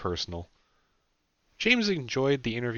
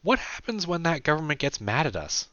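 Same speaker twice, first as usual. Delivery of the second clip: put-on higher young woman's voice; Sarah's voice; 9 semitones higher than usual. A narrator's reading. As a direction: pitch it up for Sarah.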